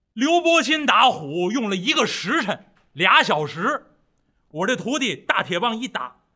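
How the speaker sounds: 响亮激动地夸徒弟; 唐僧惊叹徒弟能耐，声音又响又高; 惊喜赞叹. Acted. (兴奋赞叹，嗓门放开)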